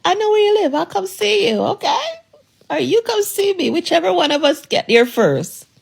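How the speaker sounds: playful, lightly sing-song mock threat; mock menace.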